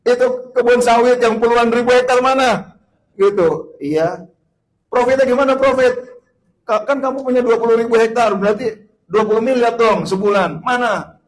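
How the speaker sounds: questioning angel. Acted. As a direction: demand answers like an interrogating angel. Angel interrogating rich man.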